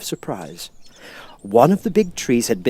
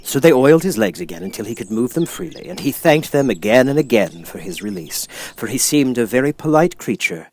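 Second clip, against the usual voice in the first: ordinary delivery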